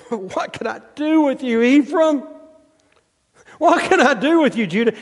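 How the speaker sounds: high, exasperated pleading voice; hammed-up exasperated father; God as frustrated father. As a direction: sigh it out like a fed-up parent.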